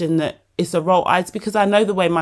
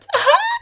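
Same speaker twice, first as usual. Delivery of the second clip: high laugh. Real, not acted. Laughing at own word.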